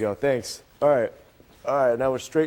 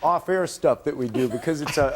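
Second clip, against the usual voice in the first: ordinary talk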